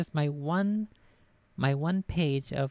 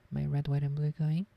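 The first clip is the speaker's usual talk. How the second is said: ordinary talk, nothing marked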